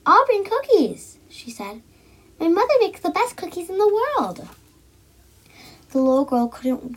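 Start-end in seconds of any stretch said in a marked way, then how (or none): 0.1-1.2 put-on high, loud, slow child's voice; little girl shooting her hand up to volunteer; eager little girl. A narrator's reading. Girl volunteering to her teacher, eager and high. 2.4-4.5 put-on higher, a bit louder child's boast; proud little girl. A narrator's reading. Girl telling class proudly, high child voice.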